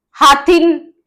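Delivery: ordinary talk, nothing marked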